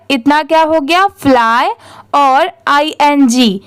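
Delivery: usual speaking voice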